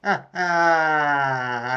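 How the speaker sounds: breathy laughing cry at the punchline; laughing it off, caught out; real laughter. Real, not acted. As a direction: crack up at the joke.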